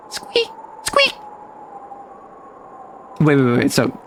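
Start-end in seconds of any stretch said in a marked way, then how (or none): 0.1-1.1 tiny high-pitched squeaks; two small, very high squeaks; squeaking noise. Pretend. Imitated squeaks after child quote.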